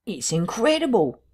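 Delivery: high-pitched character voice, mildly incredulous; lightly voiced Cuss, disbelieving. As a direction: raise the pitch in bewildered disbelief. Narrator voices Cuss in dialogue.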